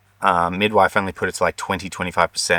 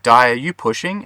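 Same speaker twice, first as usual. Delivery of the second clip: a higher, louder startled ask; surprised question; 6 semitones higher, 5 dB louder than usual. Retold or imitated. Husband and midwife asking Di if she's pushing, surprised and higher. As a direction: catch her out mid-push in surprise.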